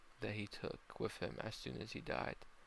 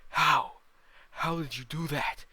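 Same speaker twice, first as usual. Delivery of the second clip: very loud, high shout; explosive roar of a beaten demon; demon bellow; 6 semitones higher, 13 dB louder than usual. Acted. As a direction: bellow in outraged disbelief. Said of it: "the demon bellows".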